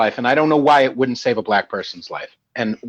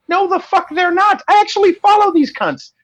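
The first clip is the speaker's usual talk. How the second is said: worked-up outburst. Real, not acted. Heated rant about his feed.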